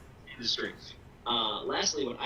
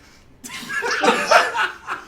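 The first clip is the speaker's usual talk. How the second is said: loud laugh; 10 dB louder than usual. Real, not acted. Pitch joke lands, big laugh.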